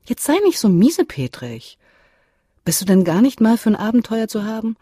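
jolly him out of his sulk; mother coaxing her grumpy son; upbeat teasing. A narrator's reading. Mother cheerfully chides her son.

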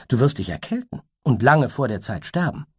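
a worried creature asking kindly; unicorn voice. Acted.

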